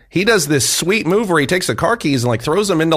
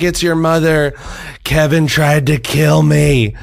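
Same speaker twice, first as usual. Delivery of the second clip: retold weepy plea, slow and raised in pitch; imitating crying mom; 3 semitones higher, slower than usual. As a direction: drag it out like a sobbing mother. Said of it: "My mom's crying".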